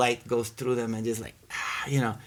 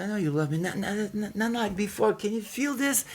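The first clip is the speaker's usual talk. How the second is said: higher, rising insistent plea; quoted pleading wonder; 10 semitones higher than usual. Retold or imitated. Quoting a woman urging him to feel it, high excited plea. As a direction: press him to feel it too. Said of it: "And she said".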